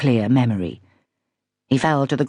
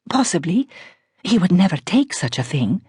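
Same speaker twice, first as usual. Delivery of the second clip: put-on posh Iranian accent; 5 semitones higher than usual. A narrator's reading. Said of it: "tremendously posh voice with its just perceptible Iranian R".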